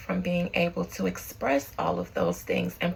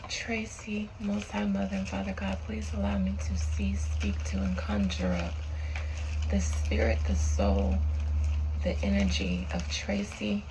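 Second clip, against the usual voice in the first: summoning a spirit; 5 dB quieter than usual. Acted.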